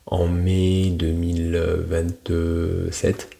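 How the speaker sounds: drawn-out, measured date announcement; slow, deliberate. Shown as a demonstration. Coach modelling a firm deadline, slow and deliberate. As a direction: stretch out each part of the date.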